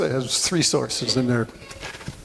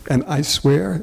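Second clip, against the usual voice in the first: usual speaking voice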